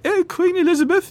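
a quick hammed-up royal impression; posh queen voice. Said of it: "it's not like the high".

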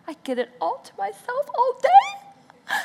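put-on high whiny kid voice with glee; imitating a gloating girl; gleeful daughter. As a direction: crow like a delighted child. Daughter gloating over basement.

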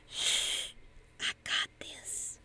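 unvoiced confident whisper; whispered shush, smugly calm. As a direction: hush her calmly, totally sure. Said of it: "in a whisper".

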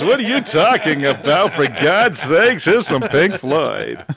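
slow, smooth DJ delivery played for laughs; teasing a friend about always talking in radio voice; mock radio voice. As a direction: ham up the on-air voice. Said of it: "The radio voice?".

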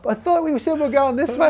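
high-pitched voice of puzzled followers; protesting mates. Retold or imitated. Mates protesting on ladder, retold.

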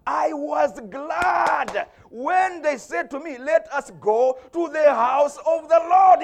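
loud, high, drawn-out preacher's shout; stretched, ringing cry of gladness; exultant psalm proclamation. Acted. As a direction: proclaim the verse slowly at full voice. Proclaims the psalm, then repeats softly.

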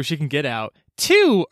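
ordinary talk, nothing marked

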